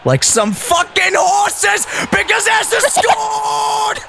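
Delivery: screaming a goal, very high and slow. Acted. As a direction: explode into the goal call. Goal call, shouting.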